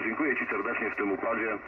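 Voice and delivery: ordinary delivery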